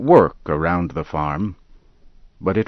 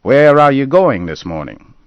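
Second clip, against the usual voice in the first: loud, higher, cheerful farmer question; hearty farmer; 8 semitones higher, 8 dB louder than usual. Acted. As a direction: call it out from the wagon. Dodge questions Henry.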